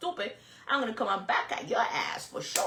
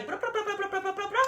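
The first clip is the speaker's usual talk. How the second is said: mock chatter; 9 semitones higher, 5 dB quieter than usual. Imitating repeated comment chatter.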